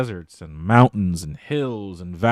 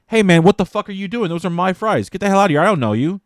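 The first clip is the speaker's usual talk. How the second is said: higher, louder indignant outburst; acted outrage, pitched up and pushy; righteously pissed; 8 semitones higher, 4 dB louder, faster than usual. Diner to a stranger stealing his fries, righteously pissed. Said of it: "righteously, you're a bit pissed at them".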